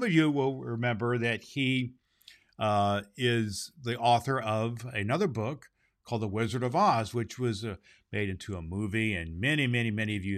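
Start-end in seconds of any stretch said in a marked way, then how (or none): none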